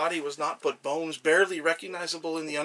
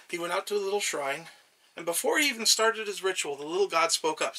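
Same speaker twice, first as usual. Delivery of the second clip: ordinary delivery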